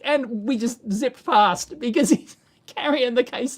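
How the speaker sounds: laughs breaking into the line; amused, laughing through it. Real, not acted. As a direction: tell it while cracking up. Laughing mid-story.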